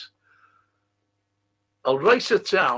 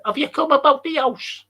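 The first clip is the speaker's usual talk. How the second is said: put-on higher and louder, a bright young girl asking; young girl's voice; 10 semitones higher, 6 dB louder than usual. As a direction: lift the voice up like the girl in the film. Girl greets lodger in film.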